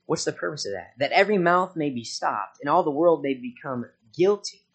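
loud preached reading of Romans; preaching scripture. Acted. Preacher reads Romans to his church, loud preaching. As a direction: proclaim it to the congregation.

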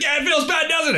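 very high mocking payback; hammed-up vindictive squeal of triumph; gloating taunt. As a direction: taunt them with their own trick. Retold revenge taunt at the clerk.

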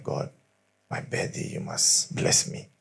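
hushed, slow pleading whisper; a murmured birthday prayer. Retold or imitated. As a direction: whisper the wish to God as if in secret. Man prays to God for blessing.

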